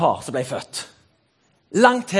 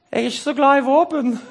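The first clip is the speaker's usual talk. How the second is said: higher, timid self-parody; hammed-up gentle soul; meek conscientious objector; 5 semitones higher than usual. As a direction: plead mildly in a raised voice. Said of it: "jeg er nok litt lik han der godeste Jakob".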